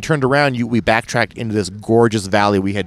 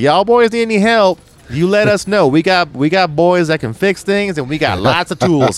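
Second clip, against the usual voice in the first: put-on loud, high-pitched Tennessee Southern accent; put-on thick Southern twang, pitched way up; big Southern accent; 9 semitones higher, 4 dB louder than usual. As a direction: holler it like a hearty Southern good ol' boy. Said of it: "a Southern accent".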